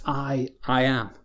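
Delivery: slow "I, I am", repeating the I for weight; solemn, slow doubled I. Shown as a demonstration. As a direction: lean on the doubled "I" slowly and solemnly. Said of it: "almost doubly emphasized".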